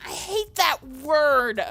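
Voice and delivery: enraged. Real, not acted. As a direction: stretch it out with heated disgust. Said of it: "you've just got me enraged".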